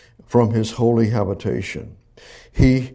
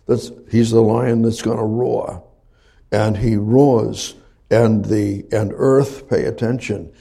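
normal talking voice

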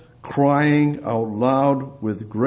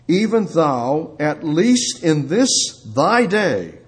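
slow, measured scripture proclamation; solemn scripture reading; 4 semitones higher than usual. Acted. Preacher quoting the Lord's words over the city, solemn and slow. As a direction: read the verse out slowly, phrase by phrase.

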